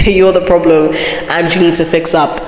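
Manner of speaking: ordinary talk, nothing marked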